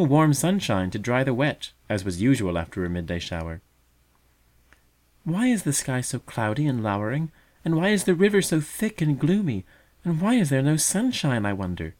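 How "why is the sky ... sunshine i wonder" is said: put-on higher, childlike questioning voice; fledgling asking its mother, higher and wondering; wondering young bird. A narrator's reading. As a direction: ask it like a puzzled fledgling. Young bird asks about weather.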